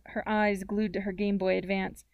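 ordinary delivery

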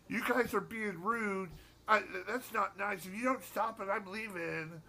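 high, slow mock-whiny complaint; whiny singer. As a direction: whine it like a hurt frontman. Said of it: "get whiny".

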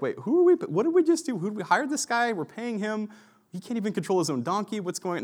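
high-pitched, incredulous string of questions; mock disbelief, pitch jumping high; bewildered officials. Acting out bewildered officials behind Balaam.

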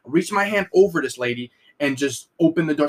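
ordinary delivery

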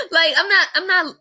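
a helpless laugh breaking into crying. Real, not acted. Grieving sister laughs despite herself mid-story, tearful. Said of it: "I don't mean to laugh".

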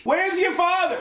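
extremely high accusing screech; screeching divorced mother. Acted. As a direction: screech it like a cartoon angry mom. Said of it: "Mom, you don't talk like that".

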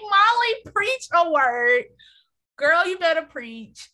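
loud, high hype-woman shout; excited cheering. Retold or imitated. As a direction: cheer her on like church. Cheering Molly on.

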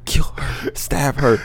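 whispered, slow urging; urgent whisper. Retold or imitated. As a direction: hiss the order so she can't hear. Voicing Brad urging Dickie secretly.

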